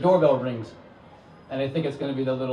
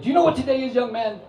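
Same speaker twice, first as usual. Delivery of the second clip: high, loud, lofty questioning voice; eccentric visitor; 10 semitones higher, 5 dB louder, faster than usual. Retold or imitated. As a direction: quiz him like a grand mystic. Eccentric visitor quizzing narrator.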